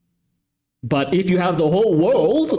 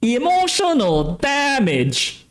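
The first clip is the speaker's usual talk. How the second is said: higher, slow Emotional damage drawn out; a slow mock-accented Emotional damage; meme accent catchphrase; 7 semitones higher, slower than usual. Shown as a demonstration. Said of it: "hold on".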